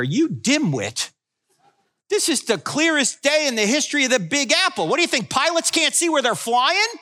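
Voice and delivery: mock fury at the commentator; indignant sarcasm, rising high; exasperated rant. Mocking the CNN commentator.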